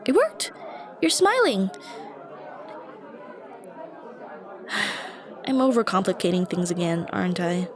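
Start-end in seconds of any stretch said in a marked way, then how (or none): 0.0-1.7 bright, much higher burst of joy; delighted. Real, not acted. Thrilled when the listener smiles back, excited. 3.8-5.4 soft laughing sigh. Real, not acted. Embarrassed at overthinking.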